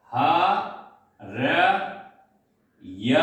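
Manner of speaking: ह, र, य said apart, each syllable alone with pauses; teacher splitting the word into letters; spelling out syllables. Shown as a demonstration. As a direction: break हरे into ह, र, य slowly. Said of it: "ऐसा समझ लो".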